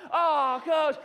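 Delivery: high, loud whine at coach; protesting girls. Retold or imitated. Girls objecting to the coach's prom warning, high mock whine. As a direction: imitate a squealing, embarrassed kid.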